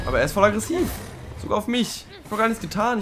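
high-pitched, wronged protest; aggrieved whine; indignant complaint. Real, not acted. Complaining creature attacks her unfairly.

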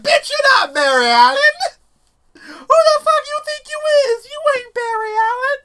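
high, loud, sassy guard voice, laughing through it. Retold or imitated. Gamer voicing guards mocking him, high, loud and sassy. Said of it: "They're like".

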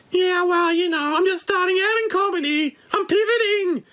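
very high squeaky puppet bat; Wuhan bat voice. Pretend. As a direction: squeak out hellos like a tiny bat. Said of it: "The Wuhan bat".

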